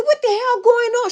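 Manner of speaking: high-pitched startled question; alarmed demand. Retold or imitated. Aunt answering her crying niece's call, high alarmed demand.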